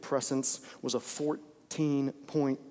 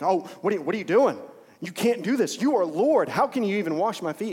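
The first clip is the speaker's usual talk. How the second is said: higher, louder, disbelieving objection; shocked protest; 5 semitones higher, 4 dB louder than usual. Retold or imitated. Peter protesting Jesus washing feet.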